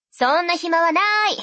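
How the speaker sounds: high, loud, curt brush-off; curt sister. Acted. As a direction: cut him off and hang up. Sister hangs up.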